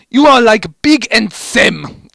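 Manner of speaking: high, loud, slow flustered gush; flustered Medic. A narrator's reading. Medic blurting feelings to Heavy, high flustered voice. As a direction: stumble over the word, flustered.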